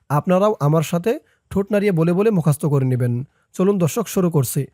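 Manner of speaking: usual speaking voice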